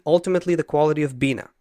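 rushed, running the words together; too fast. Real, not acted. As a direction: hurry through the phrase so it slips by. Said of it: "you said it so fast".

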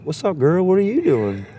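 high-pitched mock greeting to a girl; retold hello, pitched well up; acted friendly greeting. Greeting the bartender at work, acted high-pitched hello.

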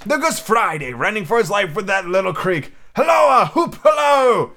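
high calls building to loud whoops; excited hallooing. A narrator's reading. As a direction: holler out to a far-off figure. Scrooge hails figures from books.

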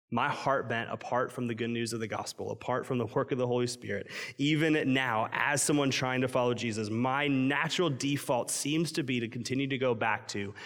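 usual speaking voice

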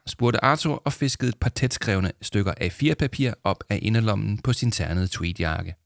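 usual speaking voice

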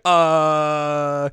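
hammed-up stalled reaction; mock dumbfounded. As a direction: stretch it out like a stunned cartoon character. Character stunned, retold.

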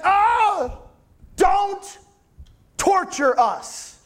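high pleading demon, torture drawn out slowly; a demon cringing and begging for mercy; a cowering demon pleads, high and slow. Acted. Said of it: "he's like".

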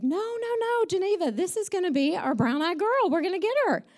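high, insistent reassurance; reassuring dad. Retold or imitated. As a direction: imitate a dad's eager, hopeful insistence. Acting out Dad reassuring Mom.

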